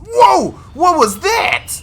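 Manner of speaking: loud, high-pitched jolt of surprise; real startled. Real, not acted. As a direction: blurt it out, caught off guard mid-thought. Startled by game mid-sentence.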